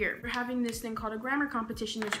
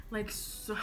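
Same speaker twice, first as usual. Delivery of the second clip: ordinary talk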